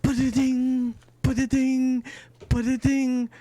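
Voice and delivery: high sing-out ba-da-ding; imitated chime. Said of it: "you hear the very familiar".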